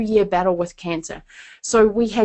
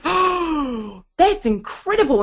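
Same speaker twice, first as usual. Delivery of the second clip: higher, wide-eyed wonder; lightly voiced amazed wife; 5 semitones higher, slower than usual. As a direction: react like it just clicked. Wife amazed after explanation.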